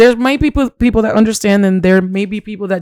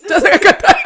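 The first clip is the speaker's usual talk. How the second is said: startled; 11 semitones higher than usual. Real, not acted. Sparkler scare, laughing panic.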